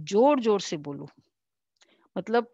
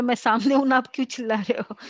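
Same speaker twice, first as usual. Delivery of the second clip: higher and louder, quick puzzled reply; puzzled retort; 5 semitones higher, 5 dB louder, faster than usual. Retold or imitated. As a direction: ask why they keep shouting when you're right there. Person called loudly from afar.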